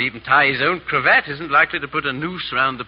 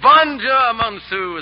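high, loud flourish on a French farewell; hearty calling out; 8 semitones higher, 5 dB louder, much slower than usual. Acted. Fop seeing off the Frenchman, calling out heartily.

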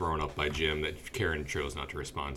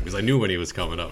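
normal talking voice

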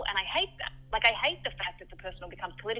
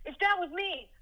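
a rough, higher imitation of a working-class Brooklyn guy; Brooklyn accent; 10 semitones higher, 5 dB louder than usual. Ex-boyfriend's blunt reply, in a butchered Brooklyn accent. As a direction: do his Brooklyn accent, badly and on purpose. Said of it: "I'm butchering his accent".

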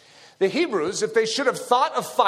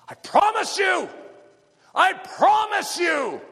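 Moses shouting a promise; 11 semitones higher, slower than usual. Retold or imitated. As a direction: urge the frightened crowd with a promise. Moses voiced encouraging the crowd.

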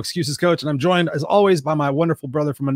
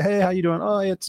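usual breezy greeting; 6 semitones higher than usual. Retold or imitated. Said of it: "normally I say".

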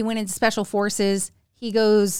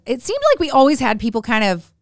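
ordinary delivery

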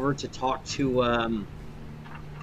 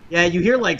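usual speaking voice

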